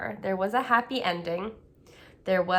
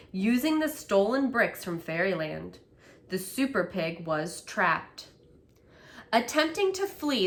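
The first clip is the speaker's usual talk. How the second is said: plain reading voice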